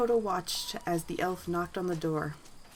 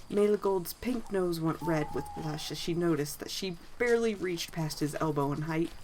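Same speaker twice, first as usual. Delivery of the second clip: plain reading voice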